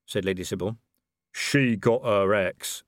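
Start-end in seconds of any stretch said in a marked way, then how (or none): 1.3-2.8 very slow, mildly accusing troll; a troll complaining about the dwarf's axe. A narrator's reading. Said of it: "said Detritus accusingly".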